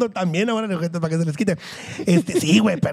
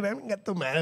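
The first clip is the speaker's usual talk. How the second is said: mock threat; 10 dB quieter than usual. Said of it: "nomás finteado".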